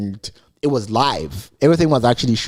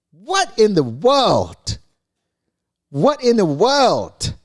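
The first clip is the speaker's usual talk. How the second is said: slow, high, drawn-out "what in the world"; mock disbelief; 7 semitones higher, much slower than usual. Host reacting to the funeral-style suits, slow high mock shock. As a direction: stretch it out in exaggerated shock. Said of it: "I'm like".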